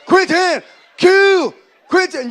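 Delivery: high, loud, stuttered name-calling; frantic DJ-like calling. Acted. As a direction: holler it like a manic DJ scratching a record. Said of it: "I sound like a D J on crack".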